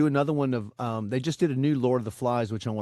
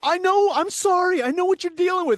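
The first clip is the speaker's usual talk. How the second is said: much higher, louder, cooing apology; soothing apology; 5 dB louder than usual. Retold or imitated. To the dog peeing down its leg, a high cooing sorry. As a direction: coo to a frightened pet like baby talk.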